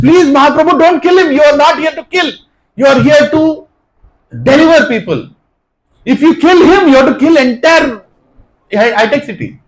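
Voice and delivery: high, loud plea with a laugh at the end; urgent pleading. Acted. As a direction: beg him not to kill. Nityananda pleading for Madai.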